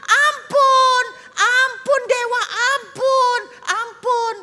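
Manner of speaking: high, loud wailing for mercy; terrified pleading. Acted. Kneeling tribe begs the false god for mercy, wailing. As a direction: beg for your life, shrieking. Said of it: "Dan mereka teriak".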